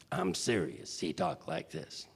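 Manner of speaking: low, half-whispered Chicago tough guy; Tony Owen voice. Retold or imitated. Showing the host how Tony from Chicago talked, low and half-whispered. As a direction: drop low and breathe it like a South Side Chicago heavy. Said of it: "And he talked like this".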